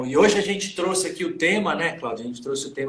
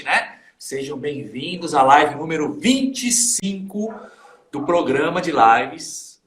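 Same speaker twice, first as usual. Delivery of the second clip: ordinary talk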